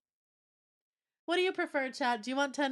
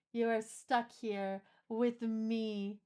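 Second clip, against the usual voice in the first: slow mock menace; much slower than usual. Playful captor talk.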